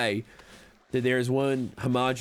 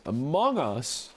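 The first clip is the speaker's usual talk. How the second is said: drawn-out, higher-pitched surprised question; astonished Southern-accented echo; incredulous echo; 3 semitones higher, much slower than usual. Real, not acted. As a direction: stretch it out in disbelief. Astonished repeat of the game named.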